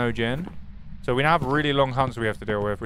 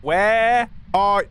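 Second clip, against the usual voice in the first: yelling at ghost; 6 semitones higher, 5 dB louder than usual. Real, not acted.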